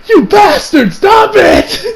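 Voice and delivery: high, mock-outraged shout; pissed off. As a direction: yell at the villain to stop. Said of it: "kinda pissed off at this".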